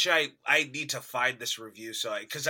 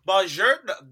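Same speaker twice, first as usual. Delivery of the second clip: higher, louder botched Italian attempt; Jeremy Irons; 4 semitones higher, 6 dB louder than usual. Podcaster mimics actor's failed accent attempt, lightly pushed greeting. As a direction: try the greeting, doomed to give up. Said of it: "He was like".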